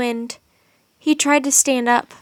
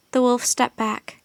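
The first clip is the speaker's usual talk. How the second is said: plain reading voice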